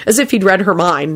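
ordinary delivery, nothing marked

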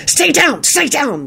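high, urgent shout; shouted warning. A narrator's reading. Lupin warns Elizabeth to stay down, urgent shout. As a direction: cry out a high-pitched warning. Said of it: "Lupin shouted".

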